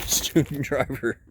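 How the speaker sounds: slow words pushed through a laugh. Real, not acted. Amused at a wrecked student driver car, laughing. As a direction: laugh while reading it out. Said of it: "I shouldn't laugh".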